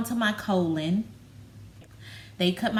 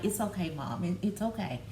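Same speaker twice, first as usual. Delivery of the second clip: softer, reassuring repeats; a daughter quietly calming her mom; soothing daughter; 7 dB quieter than usual. Retold or imitated. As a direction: comfort a tearful mother gently. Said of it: "she'll be like".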